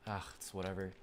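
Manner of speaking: normal talking voice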